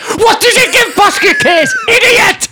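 high, incredulous comic yell; playful high-pitched scolding; mock outraged shout. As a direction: shriek it in mock outrage at a friend. Mock outrage at co-host's score.

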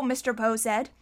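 plain reading voice

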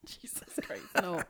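breathless horrified whisper. Real, not acted. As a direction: whisper it in mock horror. Horrified reaction to the idea.

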